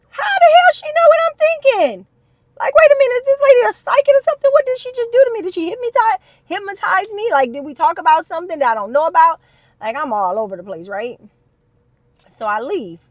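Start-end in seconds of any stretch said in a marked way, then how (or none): 0.1-9.4 much higher and louder, racing panicked inner voice; stunned disbelief. Retold or imitated. Wondering if the therapist is psychic, high and panicked. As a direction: freak out that she read your mind, high and loud. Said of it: "And I'm like".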